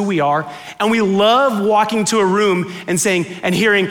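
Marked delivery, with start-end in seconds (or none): none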